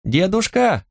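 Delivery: lightly voiced child calling into the back room; boy calling grandpa. A narrator's reading. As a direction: call out high, without raising volume. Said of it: "приглушённо донеслось до Луара".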